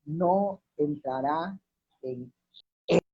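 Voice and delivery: very slow, pausing before "en" and again before "él"; weighty stress on él. Real, not acted. Preacher shows the congregation the powerful word, isolating "él" with pauses. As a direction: set "él" apart after a long pause. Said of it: "La palabra él es muy poderosa".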